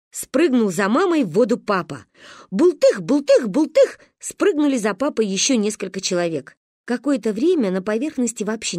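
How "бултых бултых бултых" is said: louder, higher bouncing splash words; splash imitation. A narrator's reading. Several people jumping in after father, emphatic splashes. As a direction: punch each splash in quick succession.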